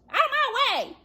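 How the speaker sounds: high, pushy command; high bossy goose. Acted. Goose to the others, bossing them high. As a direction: barge past everyone.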